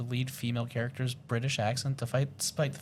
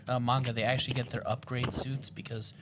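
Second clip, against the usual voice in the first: ordinary talk, nothing marked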